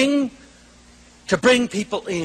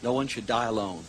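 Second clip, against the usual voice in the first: low, soft, solemn reflection; hushed, solemn; 8 semitones lower, 7 dB quieter than usual. Real, not acted. Speaker urging audience to picture death, hushed and solemn. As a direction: let it sink to a grave hush.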